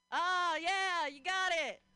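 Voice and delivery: loud, high whoop of approval; excited cheer. Real, not acted. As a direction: cheer the crowd on at full volume. Cheering the crowd on.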